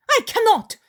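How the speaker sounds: high, loud wail of refusal; desperate cry. Retold or imitated. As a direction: cry out as if robbed. Isaac refuses again, desperate.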